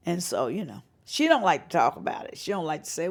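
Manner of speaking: ordinary delivery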